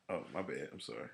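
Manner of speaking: ordinary delivery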